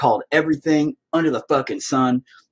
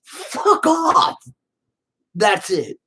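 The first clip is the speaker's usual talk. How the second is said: high, fed-up outburst; mock fed-up reply, pitched up; exasperated retort; 10 semitones higher than usual. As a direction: push back hard at the accusers. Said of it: "And it's like".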